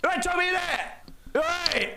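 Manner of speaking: high-pitched mock yell; imitated kids' shouting. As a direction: holler like kids across the street. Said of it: "just shout at me".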